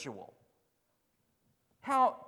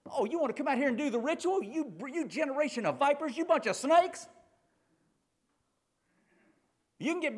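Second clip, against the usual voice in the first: fast, higher scolding prophet; John scolding; 6 semitones higher, faster than usual. Retold or imitated. John the Baptist scolding religious folks, fast and high. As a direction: rattle it off at them, scornful. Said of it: "That's what he's saying".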